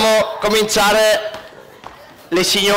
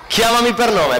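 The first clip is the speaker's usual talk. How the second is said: ordinary talk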